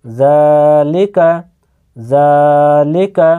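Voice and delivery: slow জালিকা with a long, held জা; drawn-out vowel. Shown as a demonstration. Teacher demonstrating one alif length, জা drawn out. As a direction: stretch the জা of জালিকা, then short লিকা. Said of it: "জালকে এক আলিফ পরিমাণ টানবেন".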